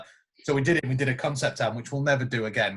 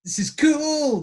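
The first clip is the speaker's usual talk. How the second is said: guitar excitement; 11 semitones higher, slower than usual. Retold or imitated. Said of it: "you're like".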